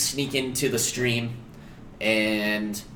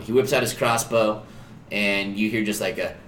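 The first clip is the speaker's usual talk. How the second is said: ordinary talk, nothing marked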